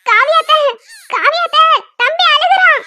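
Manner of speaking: high, loud call to another room; calling for help. Acted. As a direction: call out urgently for your aunt.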